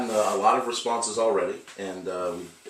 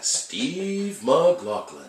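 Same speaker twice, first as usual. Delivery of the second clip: slow, stretched ring announcer call; announcer drawn-out name; 7 semitones higher, much slower than usual. Acted. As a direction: draw the name out like a boxing announcer.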